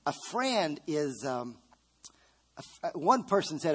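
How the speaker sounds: ordinary talk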